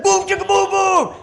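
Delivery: high, loud chant shout; hyped-up pastor. Acted. Pastor leading kids in a chant, high shout. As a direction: fire the chant out at full volume. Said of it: "He said".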